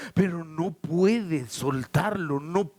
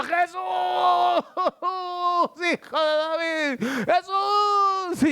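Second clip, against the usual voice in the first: high, long wailing cries; crying out harder; 12 semitones higher, slower than usual. Acted. Hushed by the disciples, Bartimeo calls all the more, crying out. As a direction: wail the name high and hold it. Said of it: "más gritaba".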